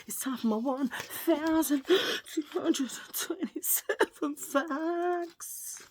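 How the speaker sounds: slow, high sing-song announcer. Pretend. Vlogger announcing her fact segment in a high showman voice. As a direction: boom out the segment title like a showman.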